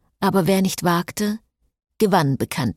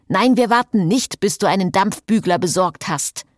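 higher, louder angry scolding; 3 semitones higher, 3 dB louder than usual. Acted. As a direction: snap back at the fussing vampire. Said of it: "schimpfte Gaylord". (lautstark schimpfend, höher)

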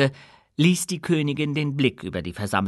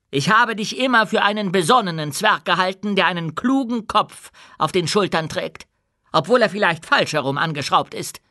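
high, pointed public reprimand; imperious queen; 7 semitones higher, 3 dB louder than usual. A narrator's reading. Queen singles out one dwarf, imperious. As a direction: needle him in front of the crowd.

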